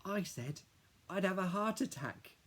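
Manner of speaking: put-on high, bright kid voice lifted well above the narrator; chirpy little brother piping up; little boy Billy. A narrator's reading. Little brother Billy telling Dad about school, high kid voice.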